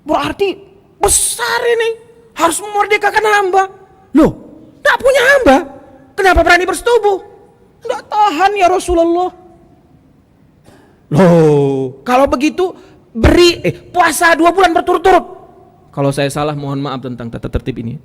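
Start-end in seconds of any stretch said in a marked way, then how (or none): 0.0-7.2 very high, raised incredulous voice; acted scolding. Prophet challenges the companion's daring, acted loud scolding. 7.9-9.3 very high, plaintive voice; acted helpless excuse. Companion excuses himself to the Prophet, high pleading. 11.1-15.4 loud, high, emphatic command; acted stern ruling. Prophet orders companion to fast, acted loud command.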